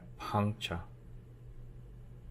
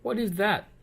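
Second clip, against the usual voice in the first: high, louder wondering question; surprised question; 13 semitones higher, 5 dB louder than usual. A narrator's reading. Little Miss Splendid spotting something in the sky, surprised. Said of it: "asked Little Miss Splendid".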